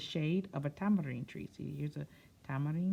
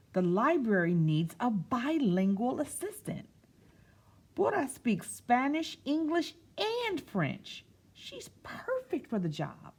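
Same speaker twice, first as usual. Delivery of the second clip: put-on bright, louder read-aloud to kids; picture-book narration; storytime reading; 7 semitones higher, 6 dB louder, slower than usual. A narrator's reading. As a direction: read it to a room of children.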